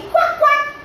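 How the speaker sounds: very high-pitched duck quacks; playful squeaky ducky voice. Shown as a demonstration. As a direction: quack like a little duck for children. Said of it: "do you like a ducky?".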